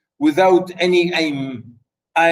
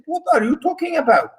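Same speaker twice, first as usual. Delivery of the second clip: high, fast, stunned outburst; a king caught off guard, voice jumping up; shocked disbelief; 11 semitones higher, much faster than usual. Retold or imitated. Oedipus hearing the accusation, shocked and disbelieving. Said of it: "really is amazed, is shocked".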